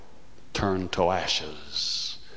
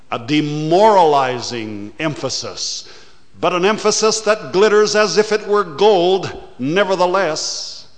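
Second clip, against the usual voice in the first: loud, higher and fast, swelling up; preaching at full force; 10 semitones higher, 9 dB louder, faster than usual. Acted. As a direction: warn them with rising fire. Preacher rises to a warning.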